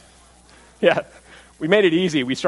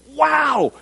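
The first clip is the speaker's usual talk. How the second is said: high, bright gasp of wonder; amazed wow; 11 semitones higher than usual. Retold or imitated. Preacher voicing the astounded crowd, amazed.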